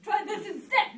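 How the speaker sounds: high, very loud retort; fierce shouted command; yelling back. Acted. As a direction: yell it as a violent comeback. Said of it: "the prince yelled back".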